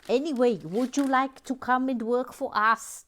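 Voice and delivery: a bad attempt at a foreign accent; mock-grand job offer in a failing accent; Mister Lego accent. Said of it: "couldn't actually do the accent".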